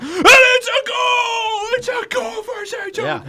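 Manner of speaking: screaming goal call, high and drawn out; goal call shout. Retold or imitated. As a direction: belt it like a hockey announcer. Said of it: "the volume that you speak at when you're broadcasting".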